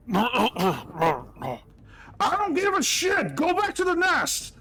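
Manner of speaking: very high, loud dismissive yell; furious chef shout. Acted. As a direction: roar her out of the kitchen. Chef yelling at Glory.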